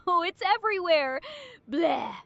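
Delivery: disgusted moan, then bleh; hammed-up vomiting; gagging retch. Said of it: "overload on barfing at the end".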